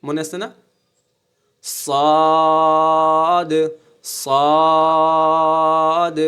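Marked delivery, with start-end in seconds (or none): 1.6-6.2 সদ with a thick s and very long, loud vowel; heavy, thick letter. Shown as a demonstration. Teacher drilling the letter sad, thick drawn-out sound. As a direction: stretch সদ out, full and heavy. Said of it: "এটা মোটা".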